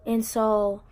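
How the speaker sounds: ordinary talk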